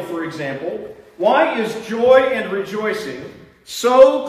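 high, slow, fervent sermon delivery; preaching. Acted. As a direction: preach each phrase with building fervour. Preaching at full force.

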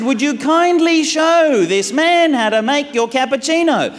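high, strained polite request; acted-out pleading, pitched high; exasperated politeness. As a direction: ask nicely through mounting exasperation.

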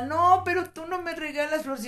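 high-pitched mock tearful protest; retold drunken crying. Wife recalling her drunken reproach to her husband, mock tearful. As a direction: imitate a tipsy, weepy objection. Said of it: "Y yo lloraba borracha".

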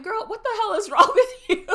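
high, loud, laughing mock bewilderment; mom's reaction. Mom answers her screaming daughter's call, exasperated. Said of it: "my mom just going".

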